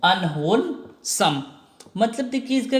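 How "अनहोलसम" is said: Unwholesome said very slowly, syllable by syllable; slow drawn out. Shown as a demonstration. Teacher slowly pronouncing the new word. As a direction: stretch the word for learners. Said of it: "क्या है?".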